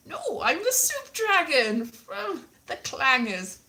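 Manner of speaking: high, slow put-on dragon voice; Soup Dragon. Dragon correcting a mistaken fan, comic high voice. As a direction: correct them in a high, drawn-out voice.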